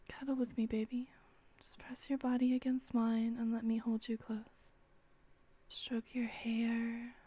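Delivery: soft breathy whispering close to the ear; intimate whisper. Acted. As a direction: whisper tenderly, drawing them in.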